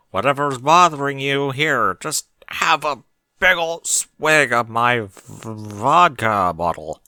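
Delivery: slow, loud, slurry offer of a drink; helpful, a bit tipsy. A narrator's reading. Berry Punch offering her vodka bottle to cheer him up, slow and loud. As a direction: push the bottle at him, drawling. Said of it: "Berry Punch said helpfully".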